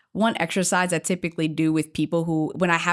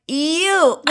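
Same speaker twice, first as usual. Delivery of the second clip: higher, louder mock pick; singling someone out; 9 semitones higher, 6 dB louder than usual. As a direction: pounce on the shy volunteer, retold. Picks out the hiding attendee.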